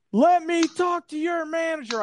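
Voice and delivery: mock shout of an irate trespasser; shouting demand. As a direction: bellow it like an entitled customer. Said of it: "And then I hear it".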